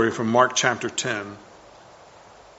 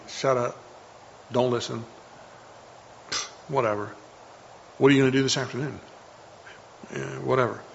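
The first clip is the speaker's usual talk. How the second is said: muttered, softer, offhand brush-offs; dismissive voices; 8 dB quieter, faster than usual. Retold or imitated. Inner voices mocking the listener, softly dismissive. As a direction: shrug it off under your breath. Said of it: "voices inside your head saying".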